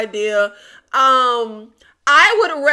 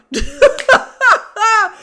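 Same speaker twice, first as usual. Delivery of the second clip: high, loud burst of laughter; real falling cackle of amusement; 9 semitones higher, 7 dB louder than usual. Real, not acted. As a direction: crack up at your own joke. Laughs at own paint-dry joke.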